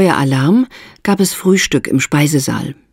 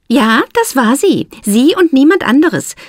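Esther, firmly confirming; 11 semitones higher than usual. A narrator's reading. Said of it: "bestätigte Esther".

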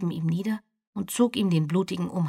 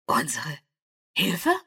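Unsere Hilfe with a rising, appalled question; horrified disbelief; slower than usual. A narrator's reading. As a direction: echo Hilfe in shocked disbelief. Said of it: "In Bertholds Stimme lag Entsetzen".

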